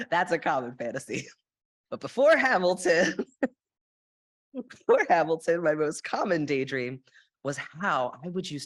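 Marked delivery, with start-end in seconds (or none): 1.9-3.1 louder, laughing and higher. Real, not acted. Laughing self-correction. 4.5-5.6 louder, laughing and higher. Real, not acted. Laughing repeat.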